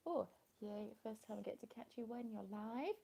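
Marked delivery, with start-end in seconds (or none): none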